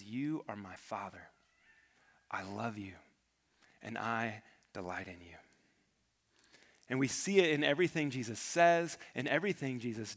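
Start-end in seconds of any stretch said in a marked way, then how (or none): 0.0-5.4 softer and lower, an intimate reply; quiet devotion. Retold or imitated. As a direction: murmur it softly, like a private vow. Jesus answering the Father quietly.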